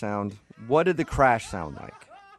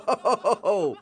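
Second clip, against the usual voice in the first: fake shock; 8 semitones higher than usual. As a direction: react as if horrified. Reacts to crash sound.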